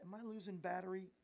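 normal talking voice

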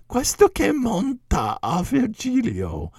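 high, slow, pointed Italian question; incredulous question. Retold or imitated. Lecturer echoing Castelvetro's question, high and incredulous. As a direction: toss it up high in disbelief. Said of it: "in a very snide way".

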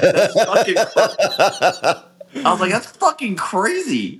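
high, drawn-out and laughing; laughing disbelief. Retold or imitated. Friend retelling grape juice runs, laughing in disbelief. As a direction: crack up mid-phrase in disbelief. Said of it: "And I was like".

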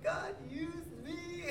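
high, drawn-out emotional wail; imitated crying cry. Crying teller to mom on getting home, acted wail. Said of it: "I'm crying".

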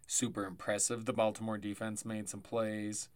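normal talking voice

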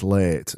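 "late" with the vowel extended and open; Barnsley pronunciation. Shown as a demonstration. Host insisting on Barnsley speech, long-vowelled "late". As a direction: hold the vowel in "late", no glide, like "lairt". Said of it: "we extend our vowels".

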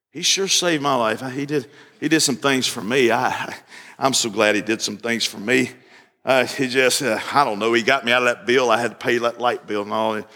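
a bored man grumbling about his light bill; mumbling lukewarm believer. Retold or imitated. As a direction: lukewarm church member shrugging off his testimony in a low mumble.

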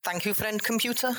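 high, overly polite apology; apologetic Jeff. Acted. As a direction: grovel politely.

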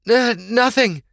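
high, flustered hesitation; stumbling over words. A narrator's reading. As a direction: trip on the word as you cover up. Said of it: "Sorsha stumbled over her words".

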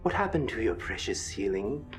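cold royal threat sinking to a whisper; menacing prince. Acted. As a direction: threaten the whole crowd like a dark prince. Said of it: "Prince Zealot's voice emanates from the figure".

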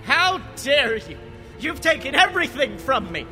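high, furious villain ranting. Acted. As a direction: rage like a betrayed tyrant. Villain's acted outburst in retelling.

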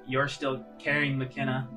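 ordinary delivery

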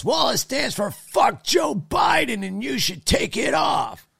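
put-on old man scolding the speaker over his shirt, high and shouting; pissy old man. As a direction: bark it like an offended old man. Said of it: "he instantly got all pissy and goes".

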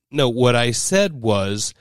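slow, patient correction; slow explanation. Retold or imitated. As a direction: spell it out slowly. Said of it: "And she said".

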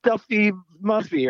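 outraged fans. Pretend. James Bond fans scolding the hosts for forgetting names, mock outraged. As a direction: imitate indignant fans yelling at the podcast. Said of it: "James Bond fans are listening, going".

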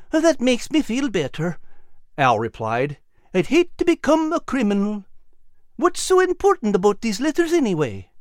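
high, eager little animal voice; Al's high voice. Acted. Al urging Nigel toward the bank, high and eager. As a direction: voice Al bright and high. Al relieved, curious.

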